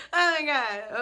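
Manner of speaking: high laugh into oh my God; laughing at her own outburst. Real, not acted. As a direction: laugh it off, exasperated.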